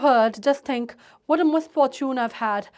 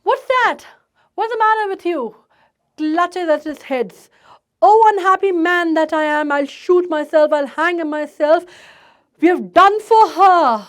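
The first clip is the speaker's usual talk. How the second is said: despairing rant; 7 dB louder, much slower than usual. Acted. Despairing father rants.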